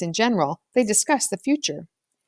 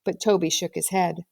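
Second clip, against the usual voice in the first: usual speaking voice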